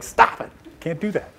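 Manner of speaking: exasperated. Retold or imitated. Audience member recalling a speaker hitting the screen, mock exasperated. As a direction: act out a fed-up reaction, lightly.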